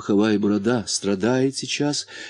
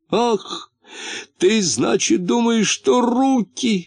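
high-pitched cry of dismay; distressed exclamation; 11 semitones higher, slower than usual. A narrator's reading. Муфта fears the hands are hurt, crying out in dismay. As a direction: exclaim it high, newly upset. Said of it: "воскликнул муфта".

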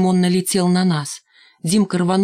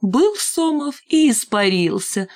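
high, drawn-out gleeful singsong; merry teasing; 6 semitones higher, slower than usual. A narrator's reading. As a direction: make fun of him merrily. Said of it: "веселилась Шмакова". (веселясь, нараспев)

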